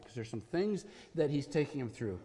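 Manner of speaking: usual speaking voice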